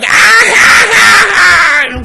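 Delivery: huge high shrieking mock laugh; big imitated laugh. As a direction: blast out a mimicked full-force cackle. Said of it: "what was her laugh like?".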